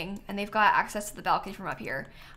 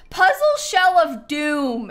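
loud, slow dramatic title read; all caps; 6 semitones higher, 9 dB louder, slower than usual. Real, not acted. Reading the creator's all-caps name, dramatic. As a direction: boom out the name like a title card. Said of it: "in all caps".

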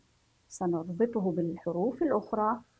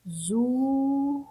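نزور split into na, zuu, ru; نزور in separate, drawn-out syllables; 4 semitones higher, much slower than usual. Shown as a demonstration. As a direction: sound out نزور very slowly, higher, with long zuu. Teacher breaks word into syllables.

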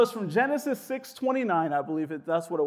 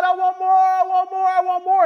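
whiny demand; 8 semitones higher, 6 dB louder than usual. Retold or imitated. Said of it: "Sometimes we're asking God".

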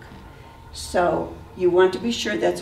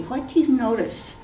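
usual speaking voice

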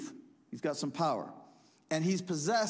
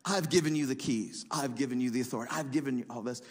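I've hit on each line, weight on it; lightly voiced Jesus, pressing each claim; insistent repetition; 4 semitones lower, faster than usual. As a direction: drive it home, line by line. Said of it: "he just told them".

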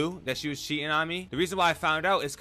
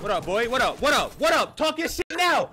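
trash talk shouted, rising higher and louder each time; taunting shout building; 9 semitones higher, 6 dB louder than usual. Real, not acted. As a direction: yell it over the fallen enemy, building up. Taunting opponent after a kill.

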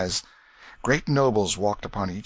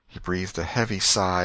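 plain reading voice

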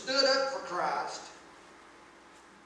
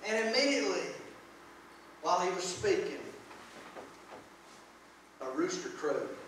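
scripture reading; 4 dB louder than usual. Acted. Reads the scripture passage aloud.

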